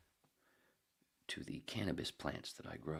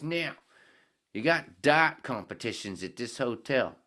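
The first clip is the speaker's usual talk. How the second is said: high, loud Aussie bloke's voice with a drift toward British; Australian accent; 7 semitones higher, 13 dB louder, slower than usual. Acted. Head orders a beer from the bartender, loud high Australian accent. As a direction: belt it out as a brash Australian drinker, way up high. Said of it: "Australian accent keep going into British".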